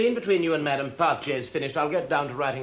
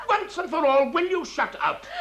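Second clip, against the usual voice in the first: furious outburst; 13 semitones higher than usual. Acted. Director silencing the laughing at rehearsal, high and furious. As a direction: explode and demand silence, pitched high. Director loses temper at interruption.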